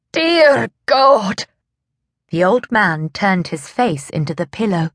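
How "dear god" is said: anguished cry. A narrator's reading. Old man's anguished outcry.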